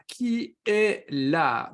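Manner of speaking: very slow, stretched-out question; drawn-out roll call. Real, not acted. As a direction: stretch each word as you scan who's here. Calling the roll of live viewers.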